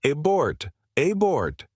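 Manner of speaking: mild narrated yell, pitched high; restrained high-pitched yell over the net; yelled order. A narrator's reading. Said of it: "Someone on the net yelled".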